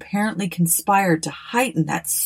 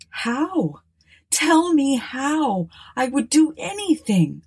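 urgent pleading; 8 semitones higher, slower than usual. Acted. Aubrey urgently offers help.

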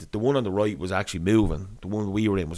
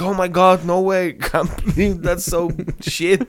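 high, loud imitation of a gushing reaction; hammed-up disbelief; mock shocked sympathy; 8 semitones higher, 4 dB louder, slower than usual. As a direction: play her appalled on your behalf, pitched up. Acting out runner's sympathetic reaction.

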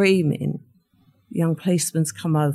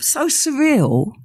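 ordinary talk, nothing marked

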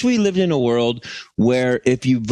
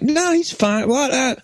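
high dismissive voice; 14 semitones higher than usual. Retold or imitated. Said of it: "They're just kind of like".

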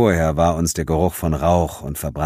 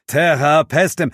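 incantation; 9 semitones higher, 4 dB louder than usual. Acted. Begins magic formula with cross.

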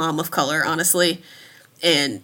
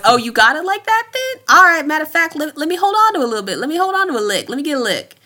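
higher and louder, teasing and fast; mock mom shakedown; 8 semitones higher, 4 dB louder, faster than usual. Mom spotting her kid's cash, teasing high voice. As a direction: play a mom claiming a cut of the money. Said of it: "it's always".

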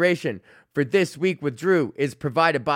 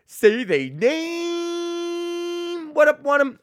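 hype-man call-out, much higher; much slower than usual. Acted. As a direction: stretch it out high like a hype man. Hyped shout-out call.

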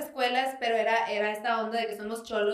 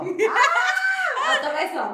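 much higher, very slow, loud laughing shout; laughing drawn-out call; 7 dB louder, much slower than usual. Real, not acted. Friend calling for another kiss, laughing shout. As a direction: stretch it out laughing.

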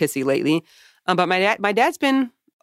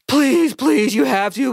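high, pleading whine, imitated; mock begging; 6 semitones higher, 4 dB louder, slower than usual. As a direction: beg like a desperate father, hammed-up. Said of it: "crying and begging".

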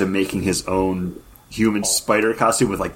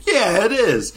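a first try at a New York accent, high; a light New York accent, tossed off; hint of a New York accent; 13 semitones higher than usual. Shown as a demonstration. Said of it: "That was my New York accent".

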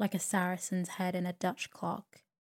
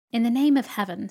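higher, much louder sudden exclamation; sudden loud outburst; 4 semitones higher, 9 dB louder than usual. A narrator's reading. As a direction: burst out with it. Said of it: "said Miss Betsy, suddenly".

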